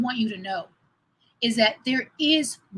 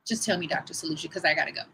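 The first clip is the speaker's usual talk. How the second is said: lower, softer, fast impatient aside; quick lowered mock impatience; 6 semitones lower, 5 dB quieter, faster than usual. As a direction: hurry him along, half joking. Impatient retort to doctor.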